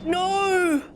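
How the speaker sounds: high, loud shocked no; shocked cry. Real, not acted. Player watching the bomb explode, shocked loud no. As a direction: cry out as it all blows up.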